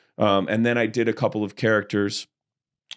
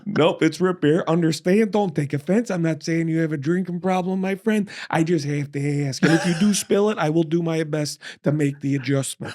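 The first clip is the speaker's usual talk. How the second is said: mock formal voice; 7 semitones higher than usual. Retold self, put-on formal voice.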